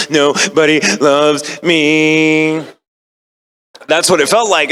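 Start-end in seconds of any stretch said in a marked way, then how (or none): 0.1-2.7 put-on very slow, higher childish whine; mock child; sulky punished kid. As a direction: wail it like a sulking child. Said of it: "like when you're a kid and you've been punished".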